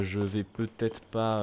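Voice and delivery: very slow, stretched peut-être pas; drawn out hesitation. Real, not acted. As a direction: drag the words out, unsure. Repeats, drawn out.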